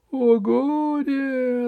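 high, drawn-out theatrical wail; sobbing gnome. Acted. Gnome mourns his bride, high hammed-up sobbing wail. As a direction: wail in squeaky despair. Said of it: "рыдал гном".

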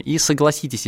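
ordinary talk, nothing marked

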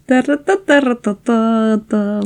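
usual speaking voice

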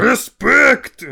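high, loud gangster bark; mock mobster shout. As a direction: yell it like a hammed-up mobster. Mocking Mafia tweet slogans.

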